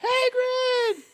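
very high, loud squealed call; kids calling out. Acted. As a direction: squeal it like a kid hailing a celebrity. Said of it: "trying to get his attention".

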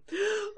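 high theatrical gasp; dramatic gasp. Acted. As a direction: gasp like a diva in shock. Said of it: "And Rarity is Rarity".

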